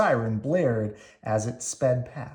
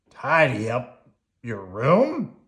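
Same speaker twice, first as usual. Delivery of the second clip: slow, louder disbelieving grouch; aghast grouch; 5 dB louder, slower than usual. Acted. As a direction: recoil at the very idea. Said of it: "cried Oscar".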